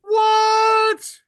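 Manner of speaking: sudden high, loud disbelieving what; shocked what. Real, not acted. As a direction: blurt it out in real disbelief. Shocked at unannounced panels.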